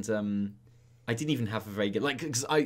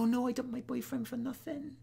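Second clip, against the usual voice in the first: higher, hammed-up dismayed teen girl; mock-dramatic regret, pitched up; girlfriend realising; 12 semitones higher, 6 dB quieter than usual. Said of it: "And she's like".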